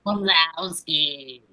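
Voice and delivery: very slow, drawn-out monster-secretary drone; droning character impression on request; Roz from Monsters, Inc. Shown as a demonstration. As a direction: stretch the name out in a cartoon voice. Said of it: "I do do that voice".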